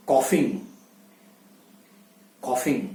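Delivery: कॉफिंग with an open vowel; कॉफिंग, the right form; correct coughing. Shown as a demonstration. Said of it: "कफिंग नहीं".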